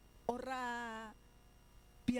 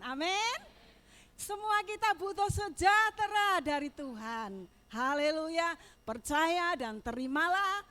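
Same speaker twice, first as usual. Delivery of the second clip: loud, ringing pulpit blessing; full-force preaching; 5 semitones higher, 15 dB louder than usual. Acted. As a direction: proclaim it over the whole congregation. (khotbah penuh semangat, suara lantang)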